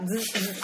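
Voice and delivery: buzzing zzz like a taser; mock electric buzz. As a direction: imitate a taser crackling.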